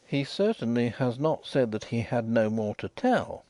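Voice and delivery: ordinary delivery, nothing marked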